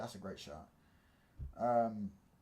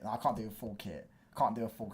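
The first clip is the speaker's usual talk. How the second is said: ordinary delivery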